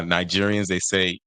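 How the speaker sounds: ordinary talk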